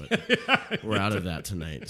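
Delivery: slow, laughing through the punchline. Real, not acted. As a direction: chuckle the words out slowly. Joking punchline to a customer.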